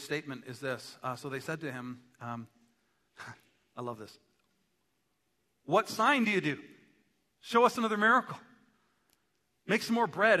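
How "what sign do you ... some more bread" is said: high, loud, insistent demands; demanding crowd. Acted. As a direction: press for a miracle like an eager crowd. Acts crowd demanding another miracle.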